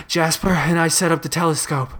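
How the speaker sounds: winded, breathy report; puffing after a run; out of breath. A narrator's reading. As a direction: push the words out between heavy breaths. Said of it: "still breathing heavily".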